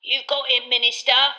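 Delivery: put-on a toothless old barman's greeting; wizened landlord greets the Minister; old landlord Tom. A narrator's reading. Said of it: "said Tom".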